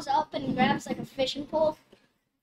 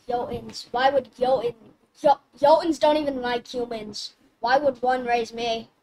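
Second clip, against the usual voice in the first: raised voice; 8 dB louder than usual. Acted. Calypso protesting to giant.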